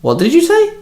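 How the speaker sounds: high, startled question; confused. A narrator's reading. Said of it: "I turned to him confused".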